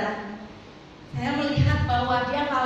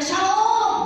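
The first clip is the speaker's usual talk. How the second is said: Shalom much higher and louder, booming; booming phone greeting; 8 semitones higher, 4 dB louder than usual. Retold or imitated. Father answering the phone, booming loud greeting. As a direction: boom it so they hear a kilometre away. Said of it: "satu kilo bisa kedengaran".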